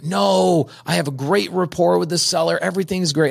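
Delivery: naive student voice. Retold or imitated. Student voiced defending seller.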